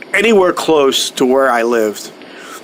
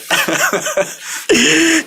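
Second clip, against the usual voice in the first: high laugh; 12 semitones higher than usual. Real, not acted. Laughing at security detail joke.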